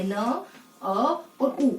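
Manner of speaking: न, अ और उ voiced as separate sounds, very slowly; sounding out. Shown as a demonstration. Teacher breaking the word no into sounds, slow and separate. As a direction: split न, अ और उ into distinct held sounds. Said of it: "तो इसको कैसे लिखेंगे?".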